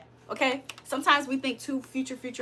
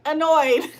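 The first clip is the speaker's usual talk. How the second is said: a louder, pointed Annoyed; pointed correction; 3 semitones higher, 6 dB louder than usual. Retold or imitated. Correcting the viewers' wrong answer, louder. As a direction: snap back the right word, louder. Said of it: "And I'm like".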